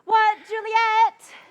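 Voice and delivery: loud, high, drawn-out call. Acted. Nurse hollers for the missing Juliet, calling out. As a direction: holler the name slowly across the house.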